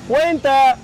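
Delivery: loud, high-pitched announcement; imitated guard's call. Guard calling prisoners for head count, imitated shout. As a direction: call it out down a cell block.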